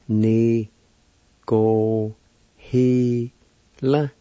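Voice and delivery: "Ni go he la" as long, separate syllables. Shown as a demonstration. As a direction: sound out "Ni go he la" with a pause after each. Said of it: "It's pronounced like this".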